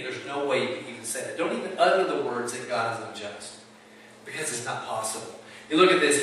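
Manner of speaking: usual speaking voice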